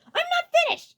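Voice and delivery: very high, loud cut-off; indignant snapping shout; angry. A narrator's reading. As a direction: shout him down. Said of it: "Iggy stated".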